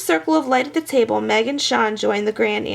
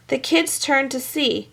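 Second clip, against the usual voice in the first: plain reading voice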